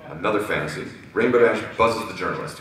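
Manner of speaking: usual speaking voice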